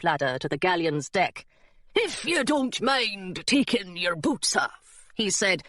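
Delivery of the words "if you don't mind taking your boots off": slow put-on pirate drawl; pirate mate politely giving an order; drawn-out salty sailor voice; pirate deckhand.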